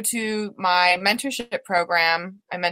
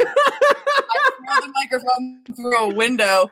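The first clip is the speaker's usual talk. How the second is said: laughing; 4 semitones higher, slower than usual. Real, not acted. Laughing at own absurd bit.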